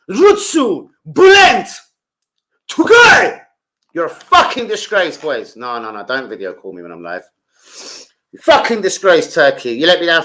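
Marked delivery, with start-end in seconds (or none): 0.1-3.4 shouting names. Real, not acted. Nostalgic about old Turkish players. 3.9-5.2 angry rant. Real, not acted. Ranting at Turkey's team. 8.3-10.2 angry rant. Real, not acted. Ranting at Turkey's team.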